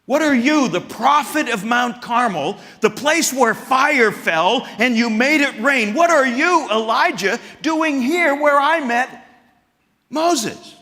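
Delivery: high, loud, mocking question; sarcastic God. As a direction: needle him like a sarcastic God. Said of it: "Why would he ask it that way".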